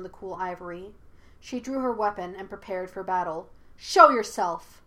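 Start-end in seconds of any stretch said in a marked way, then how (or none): 3.8-4.8 loud, slow command. A narrator's reading. Yue challenges a hidden watcher, commanding. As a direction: order them out, loudly. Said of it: "she commanded".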